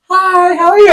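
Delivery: very high, gushing mock hello; imitated girlish greeting. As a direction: mimic her greeting way up high.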